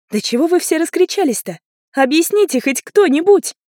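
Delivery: high, loud, protesting plea; exasperated. A narrator's reading. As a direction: demand an answer over the adults' shouting. Girl demands an explanation. (возмущённо, на повышенных тонах)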